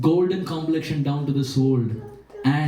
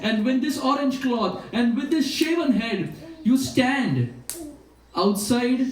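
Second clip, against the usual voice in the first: a hint of a firm instructor, higher; lightly voiced stern sakhi; 6 semitones higher than usual. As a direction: lay down the rules with firm amusement. Lalita Sakhi instructing Krishna.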